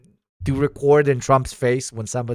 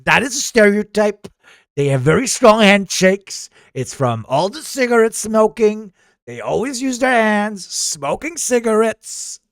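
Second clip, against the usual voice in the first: higher, emphatic mock-politician voice; boastful politician character; 8 semitones higher, 3 dB louder than usual. Remarking on the team's strong handshakes, boastful politician voice. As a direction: play a blustering leader with swooping stresses. Comic political figure riffing on delegation.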